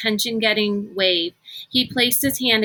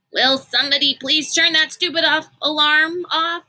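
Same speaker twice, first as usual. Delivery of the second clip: sleepy moan; 7 semitones higher, 3 dB louder than usual. Acted. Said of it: "Constance moaned in her sleep".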